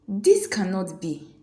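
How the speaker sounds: hissed. A narrator's reading. Jealous beauty hissing to her friends, slow and angry. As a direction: force it through in jealous anger. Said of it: "she hissed to her friends".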